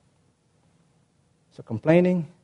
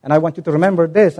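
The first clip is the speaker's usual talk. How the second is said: normal talking voice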